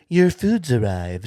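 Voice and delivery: slow, lightly voiced robot announcement; a hint of robot voice; robot server. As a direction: imitate a robot waiter, measured and slow. Robot announcing food to diners.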